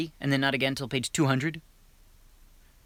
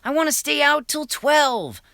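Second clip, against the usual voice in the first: son's voice pitched well up and louder; teen son voice; 11 semitones higher, 4 dB louder, slower than usual. Acted. A son pleading with his dad, in a high pushy voice. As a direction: whine back at dad like a teenage boy. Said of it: "Fred responded".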